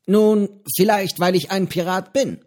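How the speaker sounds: höhere, lockere Piratenstimme, freundlich plaudernd; Johnny, der alte Pirat, prahlt gemütlich mit seinem Fisch; gutmütiger Pirat Johnny. A narrator's reading. Johnny antwortet verschmitzt. (höhere Stimme eines gutmütigen alten Piraten)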